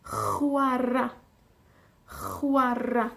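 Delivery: chwarae with a rasping throat ch sound; guttural ch in chwarae. Shown as a demonstration. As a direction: scrape the ch at the back of the throat in chwarae. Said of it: "un poquito más fuerte".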